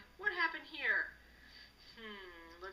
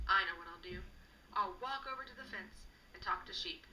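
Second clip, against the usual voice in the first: ordinary delivery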